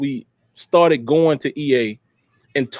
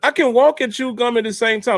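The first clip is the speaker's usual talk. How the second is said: normal talking voice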